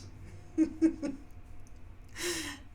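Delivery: long breathy chuckle at own punchline; real, drawn-out giggling; laughing. Real, not acted. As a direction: crack up after telling the joke.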